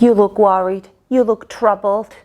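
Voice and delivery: ordinary delivery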